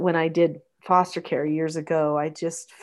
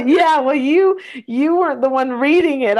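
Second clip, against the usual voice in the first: laughing; 6 semitones higher, 8 dB louder than usual. Real, not acted. Host laughs with tearful co-host.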